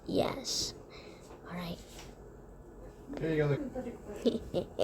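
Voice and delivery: hushed, secretive whisper. Acted.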